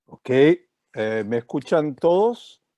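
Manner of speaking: ordinary delivery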